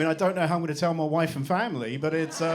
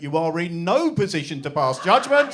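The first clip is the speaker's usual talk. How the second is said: put-on cutting teen retort; 8 dB louder than usual. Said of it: "She cut me straight off and just went".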